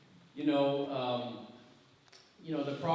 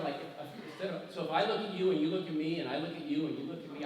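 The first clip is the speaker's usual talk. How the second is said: ordinary talk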